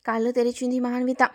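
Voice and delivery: usual speaking voice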